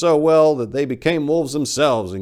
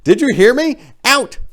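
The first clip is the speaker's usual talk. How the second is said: very high, loud furious yell; ordering out; 12 dB louder than usual. Acted. Narrator yelling the owl out of the bathroom. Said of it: "ordered the damn owl out".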